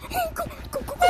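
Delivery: panicked scream. Real, not acted. Fleeing the monster.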